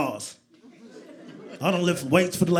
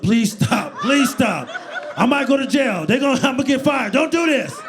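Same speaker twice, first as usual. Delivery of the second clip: loud, high frantic begging; acted-out panicked shouting; panicked pleading; 7 semitones higher, 7 dB louder than usual. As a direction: plead desperately, pitch climbing as you go. Begging wife, fears jail and firing.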